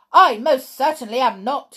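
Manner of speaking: loud indignant shout at top of voice; boy shouting his denial. A narrator's reading. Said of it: "he shouted".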